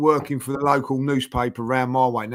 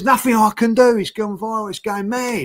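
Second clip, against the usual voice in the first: higher, agitated retold voice; worked-up mate; 6 semitones higher, 3 dB louder than usual. Mate on the phone about viral video, worked up. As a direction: imitate a mate overwhelmed by events.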